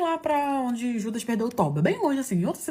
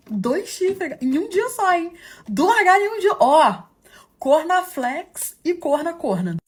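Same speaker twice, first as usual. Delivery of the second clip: much higher and louder, slow and emphatic; outraged exclamation; 8 semitones higher, 6 dB louder, slower than usual. Real, not acted. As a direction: hammer the punchline out in disbelief. Punchline exclaimed to the viewers. (exclamação indignada e lenta)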